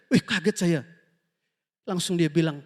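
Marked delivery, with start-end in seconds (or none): none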